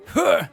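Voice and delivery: loud high effort grunt. Retold or imitated. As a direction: grunt as you stab down. Acting stabbing the ground.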